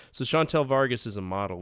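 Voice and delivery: ordinary delivery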